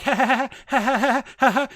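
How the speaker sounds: loud, high imitated laughing; put-on staccato ha-ha laugh; hammed-up high cackle; mock cackle. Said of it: "she's just over here like".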